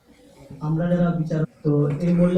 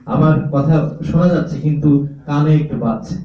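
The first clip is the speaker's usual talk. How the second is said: louder, booming right into the microphone; straight into the mic; 8 dB louder than usual. Shown as a demonstration. Judge showing singers how a mic sounds when faced head-on, loud and booming. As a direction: speak dead into the mic so it rings in the ears. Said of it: "অন্যরকম লাগছে না?".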